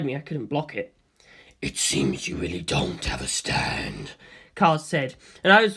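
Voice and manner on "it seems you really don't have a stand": much lower, slow and quiet; calm inhuman curiosity; low, slow, quiet voice of a detached monster; cold ancient being. A narrator's reading. Cars voice.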